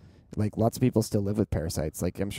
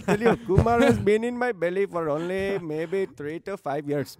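put-on Indian accent; 10 semitones higher than usual. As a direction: ham up an Indian accent, much higher. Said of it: "Needs to be like a bad Indian accent".

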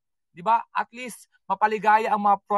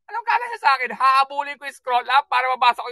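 indignant retort; 3 semitones higher than usual. Real, not acted. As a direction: pitch jumps up in comic protest. Retort to commenter's demand.

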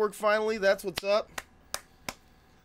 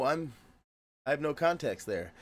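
ordinary talk

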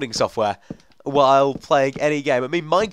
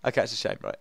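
ordinary delivery

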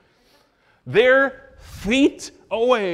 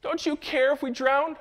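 high, slow, frightened cry to the teacher; panicked disciples; 12 semitones higher, 7 dB louder, slower than usual. Retold or imitated. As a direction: plead in terror as the boat sinks.

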